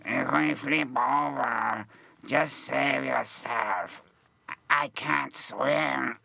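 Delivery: slow, deadpan quote of a nervous co-star; imitating Kieran. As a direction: draw it out like calmly confessing a secret. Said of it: "And he goes".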